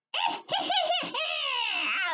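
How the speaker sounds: very high shrieked witch cackle; bad witch cackle. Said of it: "should I try my bad witch laugh".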